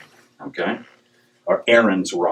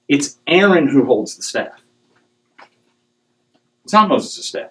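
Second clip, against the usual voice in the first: ordinary talk, nothing marked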